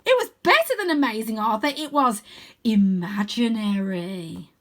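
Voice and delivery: put-on boastful boy wizard; proud wizard. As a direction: savour every word proudly.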